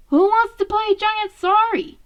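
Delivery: a big brother rallying everyone to play; eager invitation. Acted. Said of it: "Raph asked as he held it up high".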